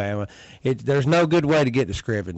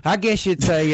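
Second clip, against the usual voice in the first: ordinary talk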